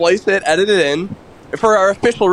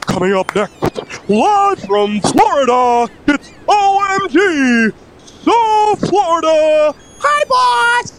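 high, booming ring announcer intro; lucha libre announcer; 6 semitones higher than usual. Acted. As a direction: build it up like a wrestling announcer. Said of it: "the wrestling announcer voice comes out".

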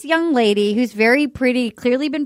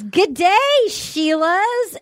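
put-on Australian accent; 6 semitones higher, much slower than usual. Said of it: "he starts talking in an accent".

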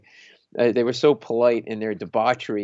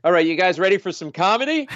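high, upbeat host's introduction; imitated stage announcer; comedy club emcee; 6 semitones higher than usual. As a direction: hype up the crowd like an emcee. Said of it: "all of a sudden I hear".

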